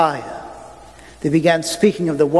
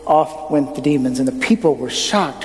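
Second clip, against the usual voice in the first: ordinary talk, nothing marked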